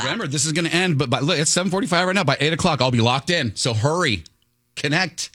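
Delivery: pushy high sales pitch; infomercial voice. Acted. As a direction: hurry them like a TV huckster. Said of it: "You sound like an infomercial".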